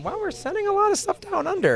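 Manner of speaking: very high-pitched wonder; high, slightly hushed astonishment; retold amazement. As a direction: marvel in a high mock voice. Retold amazed reaction to orders.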